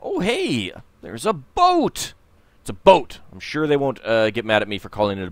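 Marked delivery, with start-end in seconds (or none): 0.1-2.1 higher, slow, delighted announcement of the boat; excited discovery. Real, not acted. Spots a boat, says it twice. 2.6-3.2 louder, emphatic repeat of the boat. Real, not acted.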